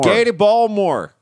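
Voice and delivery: loud, high mock Baltimore come-on; hammed-up Baltimore local calling hon; Baltimore accent. Hosts mocking a school board Zoom, in a hammed-up Baltimore accent.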